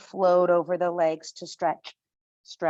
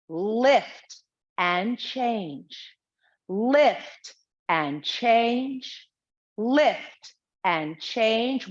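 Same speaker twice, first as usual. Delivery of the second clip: slow counting cue; much slower than usual. Shown as a demonstration. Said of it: "Now take this slow".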